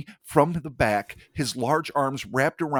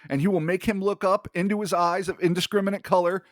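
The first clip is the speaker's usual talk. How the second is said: higher, hammed-up villain storytelling; mock grandiose; 7 semitones higher than usual. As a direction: ham up the creature's big moment. Mocking the creature's story.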